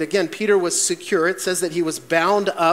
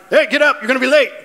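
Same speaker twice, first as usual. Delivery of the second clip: loud and higher, brisk dad voice; dad wake-up bark; 7 semitones higher, 7 dB louder, much faster than usual. Retold or imitated. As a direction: flip on the light and holler like a dad. Dad barging in to wake kids.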